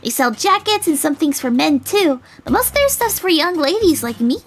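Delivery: put-on high, loud, eager girl voice; bubbly, high-pitched enthusiasm; excited girl. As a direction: gush like a girl showing off her town. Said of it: "suddenly looking really excited".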